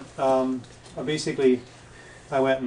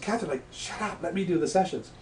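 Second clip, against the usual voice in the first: mock scolding; 6 semitones higher than usual. Scolding Catherine inside him.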